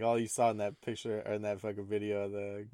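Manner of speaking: normal talking voice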